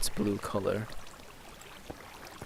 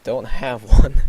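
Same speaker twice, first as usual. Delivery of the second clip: a sudden loud don't have one; loud, slow reply; 13 dB louder, slower than usual. Acted. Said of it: "he's like".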